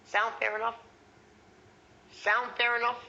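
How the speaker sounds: "Sound fair enough?" pitched high and level, an easy question; reasonable man tone. Shown as a demonstration. Trainer demonstrating a close, reasonable lifted "Sound fair enough?". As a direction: lift all of "Sound fair enough?" evenly, unpressured. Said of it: "That's the reasonable man tone".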